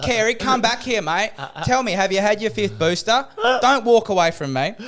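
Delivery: high, loud call across the gym; hammed-up yell after a retreating man; yelling after him. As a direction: shout at his back as he walks off.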